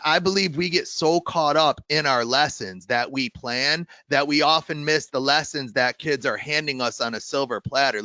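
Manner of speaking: ordinary delivery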